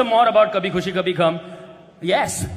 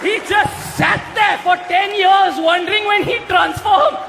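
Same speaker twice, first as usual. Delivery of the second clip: laughing mock-angry shout, higher and slower; mock outrage; 9 semitones higher than usual. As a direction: fake fury through laughter at a friend.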